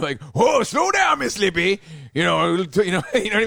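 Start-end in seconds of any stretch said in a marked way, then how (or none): 0.3-1.8 Adam Sandler impression. Quoting a movie line about the lady storming in, goofy comic impression. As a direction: whoop it out like a goofy movie man-child. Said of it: "it was like an Adam Sandler".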